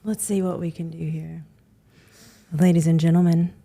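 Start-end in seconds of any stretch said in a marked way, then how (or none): 2.5-3.5 flight attendant. Pretend. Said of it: "Give us a little, uh, speech".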